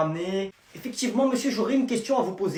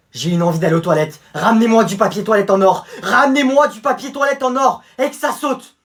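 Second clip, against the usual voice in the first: loud, higher shouted demand; demanding prince; 5 semitones higher, 10 dB louder than usual. Acted. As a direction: yell like a spoiled prince. Prince exige. (prince capricieux qui crie ses ordres)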